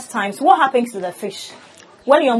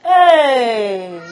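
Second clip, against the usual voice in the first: loud, long drawn-out exclamation; amused surprise; 5 dB louder than usual. Real, not acted. Host hears how much the fish eat, amused surprise. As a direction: react in amazed amusement. Reacts to fish eating a lot.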